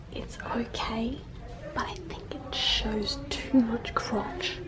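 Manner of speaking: hushed whisper in a changing room. Real, not acted. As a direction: whisper so nobody nearby hears. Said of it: "I have to whisper because I'm sure there are people around me".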